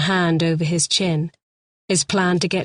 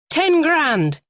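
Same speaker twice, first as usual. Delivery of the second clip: sharp high demand; 10 semitones higher, 3 dB louder than usual. A narrator's reading. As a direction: bark the ransom figure. Lee demands ransom.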